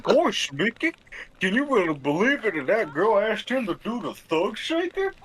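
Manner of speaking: high, swooping cartoon voice; goofy cartoon impression. Gossiping to Mickey on request, goofy cartoon impression. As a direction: pitch lurching up and down like a silly cartoon sidekick. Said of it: "Do whatever impression you were doing".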